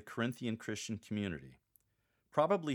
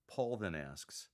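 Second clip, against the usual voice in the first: ordinary delivery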